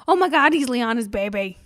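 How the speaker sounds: loud, excited gasp of realization; retold shock. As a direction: relive the big reveal out loud. Retelling shock at the big reveal.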